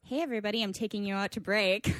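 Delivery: half-hearted announcement, a bit soft; passionless. Real, not acted. Guest taking the show out to break, passionless delivery. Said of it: "I've clearly lost my passion".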